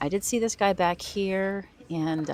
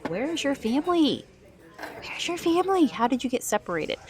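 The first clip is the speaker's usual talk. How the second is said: put-on much higher, sing-song baby voice; baby talk to seal; 9 semitones higher than usual. A shopper cooing higher at a toy seal that lost its family. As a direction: coo at the seal like a lost pup.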